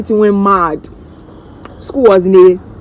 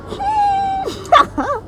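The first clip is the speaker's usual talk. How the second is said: wailing cry; 5 dB quieter than usual. Retold or imitated. Wailing to daddy on the call.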